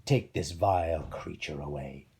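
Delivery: slow royal disdain; angry command. Acted. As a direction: order her away with disgust. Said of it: "Acrisius yelled".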